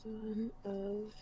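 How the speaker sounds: soft murmur while writing notes; murmuring to self. Real, not acted. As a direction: mutter the name under your breath.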